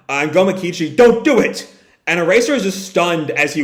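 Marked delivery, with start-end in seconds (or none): 1.0-1.7 high, loud warning cry; acted alarmed plea; urgent shout. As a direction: shout to stop a friend. Acted plea to stop a sacrifice.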